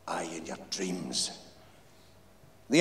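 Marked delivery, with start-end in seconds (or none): 0.1-1.3 slow wry whisper; whispered aside. A narrator's reading. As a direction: whisper it under your breath.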